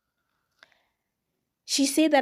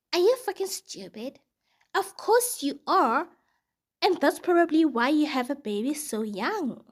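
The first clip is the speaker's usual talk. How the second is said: put-on insulting old lady; 5 semitones higher than usual. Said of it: "She says".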